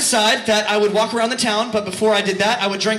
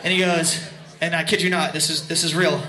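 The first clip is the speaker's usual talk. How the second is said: ordinary talk, nothing marked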